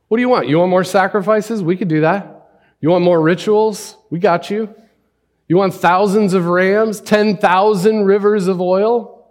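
exasperated, high, loud mock whine of a defender; a defender mockingly bargaining with God, high and loud; mocking paraphrase. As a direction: mimic a people throwing offerings at God. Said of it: "standing up and essentially saying".

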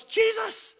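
very high, loud cry of the name; excited shout. Acted. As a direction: shout it out in joy. Peter cries out on water.

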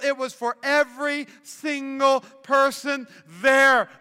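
loud, slow, emphatic proclamation; fervent preaching. Real, not acted. Preacher on the boy's one act of obedience, loud fervour. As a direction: drive each phrase out with full conviction.

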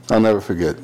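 ordinary delivery

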